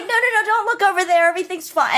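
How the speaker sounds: very high, rushed mock panic; hurried cover-up. Family distracting grandmother from the crying, high hurried false reassurance. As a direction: imitate relatives frantically waving off a question, high and quick. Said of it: "And we're like".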